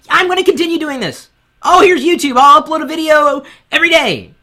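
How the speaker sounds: high, loud gushing beginner; overeager newbie. Acted. New creator announcing big plans to everyone, overeager. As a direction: gush like a naive new creator.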